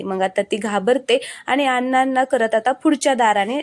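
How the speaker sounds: ordinary delivery, nothing marked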